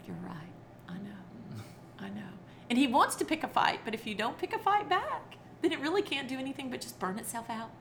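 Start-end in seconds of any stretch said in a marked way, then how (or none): 0.1-2.3 whispered soothing; soft answer. Retold or imitated. Parents calming angry son, soft whisper. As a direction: hush an angry kid gently. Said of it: "we would just say".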